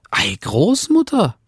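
put-on high, wondering child's call; young girl calling out. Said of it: "rief es". (hohe, staunende Kinderstimme ruft die Großmutter)

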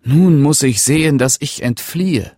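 panic, he has to flee now; grief and fear. A narrator's reading. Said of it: "sprach voll Trauer und Angst".

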